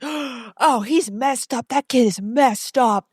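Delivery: gasping, hammed-up alarm; mock an overdramatic, scandalized reaction; over the top. Mimicking her reaction to a rude teen, over the top. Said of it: "over the top for me".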